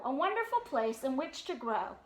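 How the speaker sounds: ordinary delivery, nothing marked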